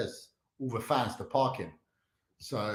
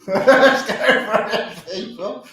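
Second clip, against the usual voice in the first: laughing; 7 semitones higher, 9 dB louder, slower than usual. Real, not acted. Laughing while starting attempt.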